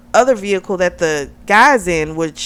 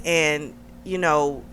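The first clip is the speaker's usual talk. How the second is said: ordinary talk, nothing marked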